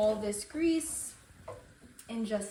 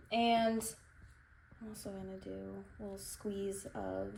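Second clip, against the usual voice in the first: ordinary talk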